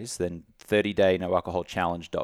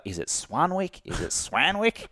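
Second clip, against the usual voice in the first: puzzled Americans; 9 semitones higher, slower than usual. Retold or imitated. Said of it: "they were like".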